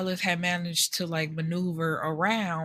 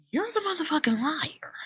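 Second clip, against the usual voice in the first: 1 whispered insult; 8 semitones higher than usual. Real, not acted. Whispered aside while recapping drama.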